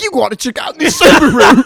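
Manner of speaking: high, loud mock Australian ad voice; Australian car salesman. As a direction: pitch the Subaru like a pushy Aussie car dealer. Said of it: "like a guy trying to sell used cars".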